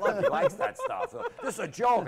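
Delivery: high, slow, laughing; laughing through it. Retold or imitated. Comic quoting the broker while laughing hard. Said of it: "I'm like".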